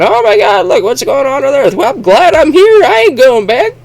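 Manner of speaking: high, loud gleeful settler voice; hammed-up carefree glee; smug settler. Settler gloating about staying on Mars, high mocking voice. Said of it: "Instead of just saying".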